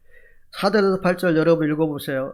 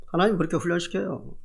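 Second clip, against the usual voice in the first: ordinary delivery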